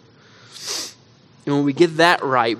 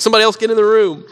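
panicked plea; 8 semitones higher, 6 dB louder, much faster than usual. Retold or imitated.

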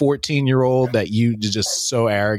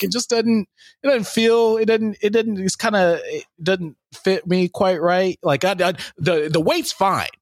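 mock sheepish excuse; 6 semitones higher than usual. David making excuses about armor.

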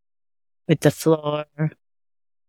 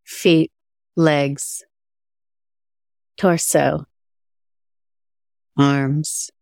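guided meditation. Acted. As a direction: guide attention gently through the body.

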